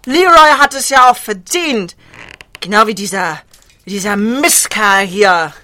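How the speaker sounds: loud, indignant outburst; angry. Acted. Attacker justifies hurting her lover and this captive, angry. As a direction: spit it out loudly at the man.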